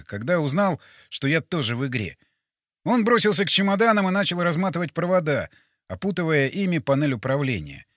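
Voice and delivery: ordinary delivery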